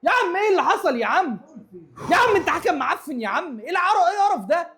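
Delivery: high, loud angry tirade at the ref; furious rant. Real, not acted. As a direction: rage at the referee, full throttle. (المشجع يصرخ غاضبًا على الحكم)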